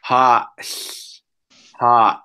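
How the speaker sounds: ha with a glottal stop before it; Lushootseed word. Shown as a demonstration. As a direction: catch the breath in the throat, then ha. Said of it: "I'll say it twice".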